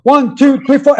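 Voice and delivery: high, rhythmic count-in; imitating a count-off on the sticks; drummer's count-in. Acts out counting the band in.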